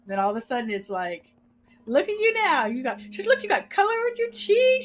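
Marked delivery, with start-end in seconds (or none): 1.9-4.9 higher, louder, delighted teasing; amused daughter marveling; teasing amazement. Retold or imitated. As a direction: marvel at the change. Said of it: "She's like".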